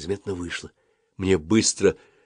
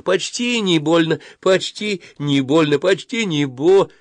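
slow, high, cheery singsong reassurance; cheerful coaxing; 9 semitones higher, slower than usual. A narrator's reading. Dentist soothing a boy as she drills, cheerfully. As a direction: coax slowly in a raised voice. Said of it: "весело шептала мне врачиха".

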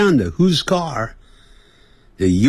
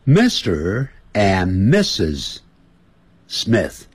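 慢读 Mister and Missus Smith; 拉长名字示范; slow model phrase; much slower than usual. Shown as a demonstration. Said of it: "一定要加个to". (一字一字慢念名字)

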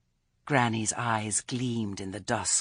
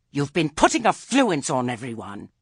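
loud, raised-pitch interruption; angry accusation; 5 semitones higher, 9 dB louder than usual. A narrator's reading. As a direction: cut in with an angry charge. Enraged Lettice interrupts with accusation.

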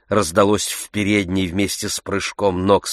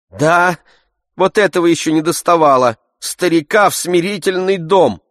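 Oblomov voiced; 9 semitones higher, 5 dB louder than usual. A narrator's reading.

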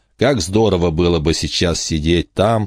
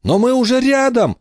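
put-on high boy's voice yelling into the storm; shouting over wind. A narrator's reading. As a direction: shout it back, high and desperate. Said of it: "прокричал в ответ Майкл".

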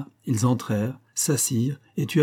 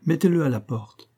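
higher-pitched, decided command, falling at the end; firm order; 6 semitones higher than usual. A narrator's reading. Philosopher telling the host to evict him, firm order. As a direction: order "à la porte" as a settled verdict. Philosopher's verdict, then echoed.

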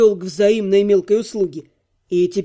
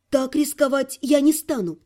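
put-on higher boyish voice refusing firmly; cutting refusal; 6 semitones higher than usual. A narrator's reading. Servant boy declining the risky theft, firm refusal. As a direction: turn it down as a lad who won't budge. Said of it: "отрезал парень".

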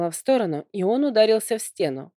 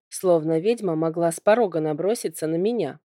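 ordinary delivery

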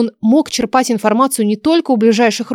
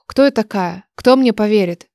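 ordinary delivery, nothing marked

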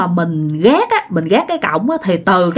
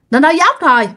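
ordinary delivery

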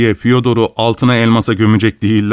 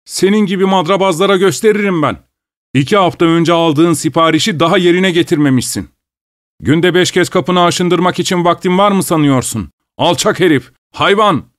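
higher, angry Budala, aptal, eşek; angry customer shouting; 7 semitones higher than usual. A narrator's reading. Furious customer insults cobbler Fyodor, higher angry shout. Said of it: "öfkeyle bağırıyordu".

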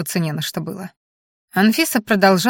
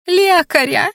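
high-pitched frantic cry for help; hysterical scream; 11 semitones higher, 4 dB louder, slower than usual. A narrator's reading. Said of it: "истерично завопила Анфиса".

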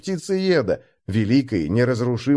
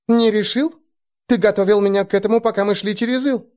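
put-on high, quick surprised young girl; 9 semitones higher than usual. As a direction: react in disbelief, voice jumping up. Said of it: "с удивлением подняла брови".

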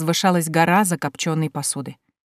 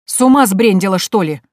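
loud, indignant question; acted exasperated scolding; angry outburst; 3 semitones higher, 7 dB louder than usual. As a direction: snap it in outraged disbelief. Uncle outraged at his niece.

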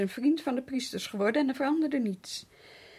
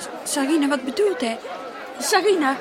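raised voice calling the maid by name; 3 semitones higher, 7 dB louder than usual. Acted. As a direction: call Sarina loudly across the room. Mistress calls for the servant.

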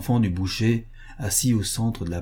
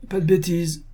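higher-pitched, lightly acted soldier; Cossack's brusque interjection; 9 semitones higher than usual. A narrator's reading. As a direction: cut in as the tipsy soldier. Cossack steps up to the butcher.

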